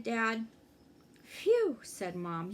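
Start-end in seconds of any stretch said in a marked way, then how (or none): 1.2-1.8 relieved phew. A narrator's reading. Mom relieved after scare.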